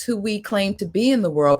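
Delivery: ordinary talk, nothing marked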